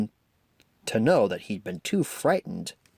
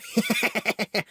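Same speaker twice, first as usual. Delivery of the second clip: mocking laughter; 10 semitones higher than usual. A narrator's reading. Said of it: "roaring with laughter".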